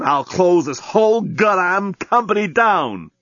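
high, slow, emphatic threat; angry exclamation. A narrator's reading. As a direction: threaten the whole company, drawing out each word. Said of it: "exclaimed Dan".

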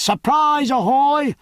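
high, ringing sea hail; shouted hail. A narrator's reading. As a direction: hail the ship from the water. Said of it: "he drew breath and shouted".

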